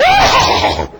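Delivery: loud, drawn-out "Oh!"; re-enacted startled holler. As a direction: replay a shocked outburst at full volume. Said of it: "I said".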